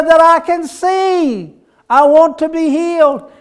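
high, loud, slow plea; pleading cry. Acted. Blind man pleading with Jesus to see, high and loud. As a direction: beg to be healed.

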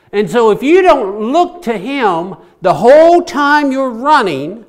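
loud, high-pitched sermon delivery with swooping emphasis; forceful preaching. Real, not acted. Preacher urging the congregation to look to Jesus, forceful preaching. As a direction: preach it hard, lifting key words.

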